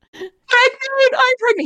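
high-pitched sobbing blurt, imitated; re-enacted tearful crying. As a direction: act out crying hysterically with happy news. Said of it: "You're hysterically crying".

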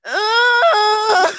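high, loud, drawn-out mock groan; hammed-up groan of someone struggling up; loud imitated groan. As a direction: imitate him with a big strained "ugh". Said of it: "And then he's like".

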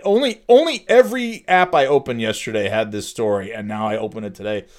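usual speaking voice

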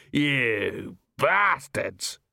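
drawn-out furious curse; angry shout. A narrator's reading. Baker rounds on the thieves, drawn-out anger. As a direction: stretch the curse out in rage. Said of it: "he shouted".

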